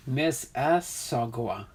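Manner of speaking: "Miss Mississauga" slowly, in separate syllables; careful, spaced-out "Miss Mississauga"; broken down slowly. Shown as a demonstration. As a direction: sound out "Miss Mississauga" part by part. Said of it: "you gotta break it down".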